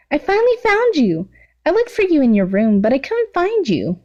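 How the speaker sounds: put-on high, giddy girl's squeal; excited high voice for the villain girl; Toga's voice. Toga voiced again.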